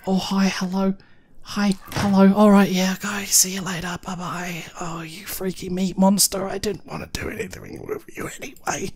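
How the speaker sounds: small, high, hushed voice; timid mock greeting. Player excusing themselves from a meat monster, timid mock politeness. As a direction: back away with soft, high, awkward pleasantries.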